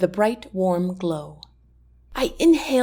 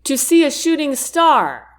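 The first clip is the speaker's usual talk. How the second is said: called out a little higher and louder; calling back; 6 semitones higher, 4 dB louder than usual. A narrator's reading. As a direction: call it across the lawn. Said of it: "Jack calls back".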